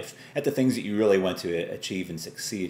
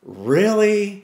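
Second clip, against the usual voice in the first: rising to a loud, higher disbelieving Really; exasperated outburst; 10 semitones higher, 5 dB louder, slower than usual. Retold or imitated. Driver whose car won't start, loud exasperated disbelief. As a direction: imitate your own fed-up outburst at the car. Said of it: "And you're like".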